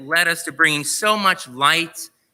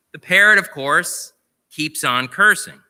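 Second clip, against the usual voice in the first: ordinary talk, nothing marked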